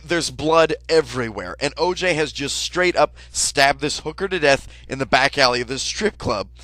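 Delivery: ordinary talk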